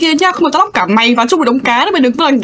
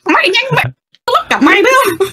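character threat; 7 semitones higher than usual. Acted.

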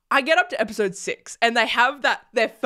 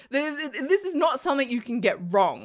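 ordinary delivery

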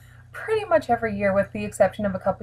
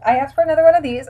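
fast, louder reveal; speeding up to reveal; 6 semitones higher, 6 dB louder, much faster than usual. Real, not acted. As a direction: rush out the big reveal. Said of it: "wait for it".

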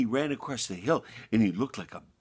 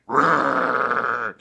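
loud, growling bear roar; big storybook bear roaring at the lamb; 3 semitones higher, 10 dB louder than usual. Acted. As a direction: open wide and roar like a hungry bear. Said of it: "he opened up his big bitey mouth".

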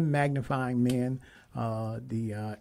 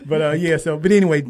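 laughing; 6 semitones higher, 16 dB louder than usual. Real, not acted. Laughing at own crying story.

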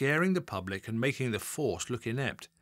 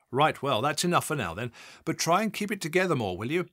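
louder, higher, fast wrap-up; brisk boss; 4 semitones higher, 4 dB louder than usual. Acted. Assistant chief constable ending the call with a brisk warning.

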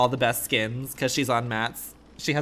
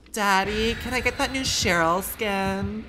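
lightly voiced whiny girlfriend, higher; mock spoiled girlfriend; 7 semitones higher than usual. Girlfriend begging her rich man for a skin, mock sweet. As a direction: ask daddy sweetly for a skin. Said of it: "This is Zoho to Matt in ten years".

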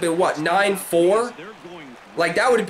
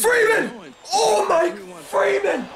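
high, laughing cries of the batter's name; excited laughing; 10 semitones higher, slower than usual. Real, not acted. Player cheering his batter's big hit, laughing high.

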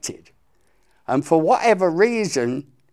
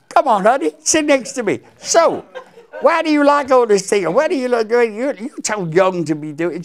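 a fond imitation of a caring older singer; 10 semitones higher, 5 dB louder than usual. [name] voiced in retold story.

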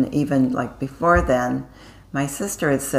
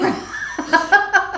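high laugh. Real, not acted. Laughing at own story.

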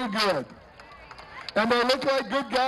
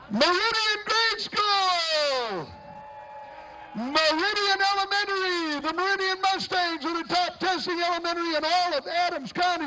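parade announcer; 5 semitones higher than usual. Acted. Parade emcee to school float, booming announcer. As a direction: call it out to the whole street.

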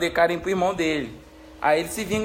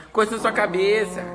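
ordinary talk, nothing marked

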